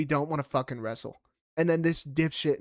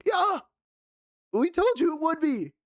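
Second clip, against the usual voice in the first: vindicated outburst; 14 semitones higher than usual. Real, not acted. Vindicated outburst on bad call.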